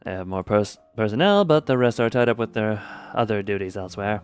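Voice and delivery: lightly voiced calm briefing officer; character voice.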